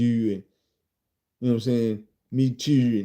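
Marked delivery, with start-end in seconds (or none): 2.3-3.0 Baltimore "Me too" imitated; Baltimore accent. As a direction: drawl it Baltimore style. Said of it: "This bitch got a Baltimore accent".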